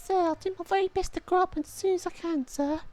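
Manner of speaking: put-on very high, bright child's plea; eager little boy. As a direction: squeak it up like a kid begging the captain. Child answers captain.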